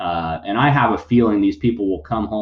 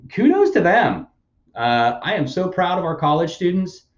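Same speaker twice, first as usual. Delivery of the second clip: sarcastic praise; 8 semitones higher than usual. Real, not acted. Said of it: "hear the tone of sarcasm in my voice".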